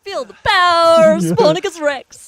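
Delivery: high, loud preacher proclamation; Southern preacher voice. Acted. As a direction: call it out like a tent-revival preacher. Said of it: "a Southern preacher voice instead".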